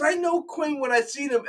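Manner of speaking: a light high-pitched fan voice; fan insisting. Retold or imitated. Fan insisting to his friend, lightly high voice.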